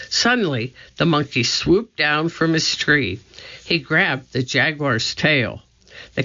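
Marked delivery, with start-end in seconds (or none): none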